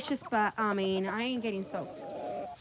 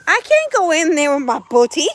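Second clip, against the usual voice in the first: voicing the hen; 4 semitones higher, 9 dB louder than usual. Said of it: "She's like".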